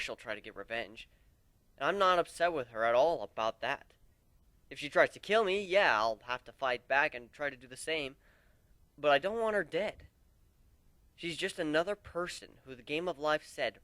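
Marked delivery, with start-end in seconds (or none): none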